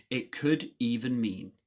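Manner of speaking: usual speaking voice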